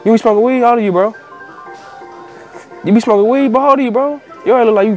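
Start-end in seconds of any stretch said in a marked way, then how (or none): none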